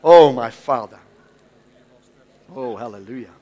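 ordinary talk, nothing marked

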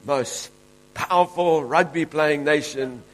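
building preacher's proclamation, slowing at the end; preaching. Real, not acted. Preacher promising the congregation victory, slow and emphatic at the end. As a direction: drive the promise home.

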